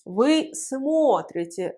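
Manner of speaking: смотрите slowly, stressed on a full о. Shown as a demonstration. Teacher showing the accent stays, смотрите stressed on о. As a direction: lean long on the о in смотрите. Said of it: "L'accent reste sur le O".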